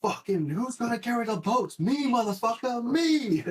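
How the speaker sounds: shouted hype quote, peaking at the end; yelling David Goggins quotes. Retold or imitated. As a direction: bellow it like a motivational drill instructor. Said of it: "I'm, like, yelling out David Goggins quotes".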